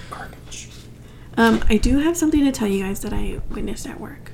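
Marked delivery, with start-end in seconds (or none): 0.1-0.7 hushed whisper. Shown as a demonstration. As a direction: whisper it under your breath. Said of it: "Whisper it".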